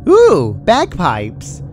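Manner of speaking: slow, loud, very high delighted coo; delighted ooh. A narrator's reading. Pinky finding a new toy in the organs, slow loud delight. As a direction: stretch it out with wonder. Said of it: "she said".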